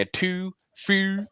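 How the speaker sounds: mock Baltimore accent, high; a hammed-up Baltimore voice on "Two, few". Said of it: "be talking like that".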